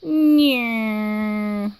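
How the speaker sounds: buzzy nasal plane drone; kid's mouth-made airplane buzz; airplane noise. A narrator's reading. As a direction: zoom like a toy airplane. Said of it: "made an airplane noise".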